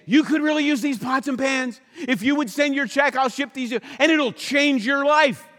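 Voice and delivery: high, hammed-up infomercial salesman; mock salesman pitch. Mocking a TV chef selling pots and pans, high hammed-up pitch. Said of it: "that accent, he's selling all this stuff".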